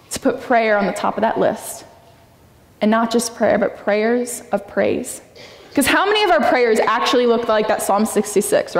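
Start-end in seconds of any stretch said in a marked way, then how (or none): none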